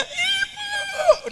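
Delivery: put-on high shouted Ibu! in a child voice; excited kid voice; child's call. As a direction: yell like a little kid spotting mom. Child greeting mother.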